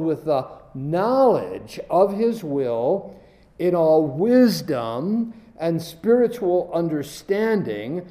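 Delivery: slow, each word weighed; slow stressed phrase. Shown as a demonstration. Preacher slowing to stress the key words. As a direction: draw out knowledge and spiritual. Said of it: "as I stress certain words".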